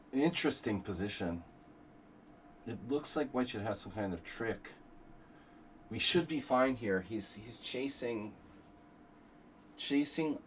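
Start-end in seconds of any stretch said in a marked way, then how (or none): none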